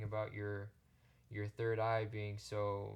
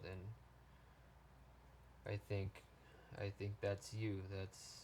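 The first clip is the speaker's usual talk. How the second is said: choked up; 8 dB quieter, much slower than usual. Real, not acted. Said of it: "I can stop getting all choked up".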